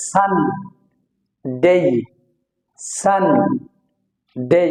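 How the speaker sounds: সান held long, pause, then ডে apart; stretched syllables. Shown as a demonstration. Teacher showing the underlined N must be stretched, stretched syllables. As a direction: split সান and ডে with a wide gap. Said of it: "এটা stretch করে বলতে হবে".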